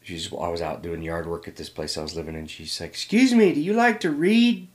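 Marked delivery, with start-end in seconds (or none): 2.9-4.7 very high, loud southern missionary sister; southern accent. Retold or imitated. Missionary sister approaching someone doing yard work, in a southern accent. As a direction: call out slowly in a high-pitched southern voice. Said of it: "here he comes with the southern accent".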